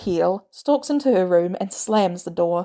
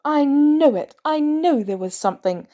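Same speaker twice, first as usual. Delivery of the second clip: angry outburst; 7 semitones higher, 3 dB louder than usual. A narrator's reading. May's accusing outburst.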